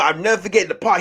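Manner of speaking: ordinary delivery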